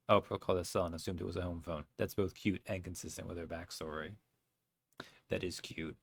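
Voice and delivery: normal talking voice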